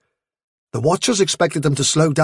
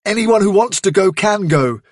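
defiant declaration; 6 semitones higher, 3 dB louder than usual. Acted. Leader refusing to flee.

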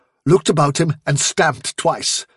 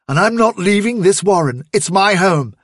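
higher, emphatic refusal; defiant declaration; 6 semitones higher, 4 dB louder than usual. Acted. Hazel refusing to leave the warren, defiant declaration. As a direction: dig in with firm defiance.

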